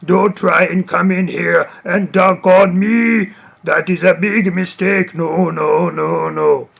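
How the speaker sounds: rumbly African-accented shot-blocker impression; Dikembe Mutombo. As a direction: scold a challenger in an imitated African accent. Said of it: "an African accent of Dikembe Mutombo".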